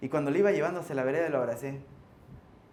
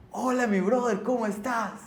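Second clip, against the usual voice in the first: high-pitched friendly hello; acted, over-friendly welcome; effusive greeting; 7 semitones higher than usual. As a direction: greet him like an old buddy. Acted friendly greeting to wary stranger.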